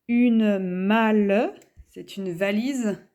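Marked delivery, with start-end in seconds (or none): 0.1-1.5 very slow and louder, word by word; dictation pace. Shown as a demonstration. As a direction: dictate it clearly for the class. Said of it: "dictating words to the class".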